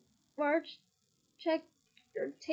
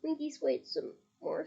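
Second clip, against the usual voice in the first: ordinary delivery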